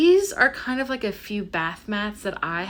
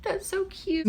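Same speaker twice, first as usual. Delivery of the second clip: much higher, softer cooing voice; high cooing reaction to the cat; 11 semitones higher, 5 dB quieter than usual. Retold or imitated. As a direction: melt into a high coo. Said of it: "And I'm like".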